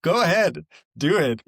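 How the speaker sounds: loud, high, insistent egging-on; playful urging. Real, not acted. Friend egging the host on to cry, loud and playful. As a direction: push them loudly into it, half joking.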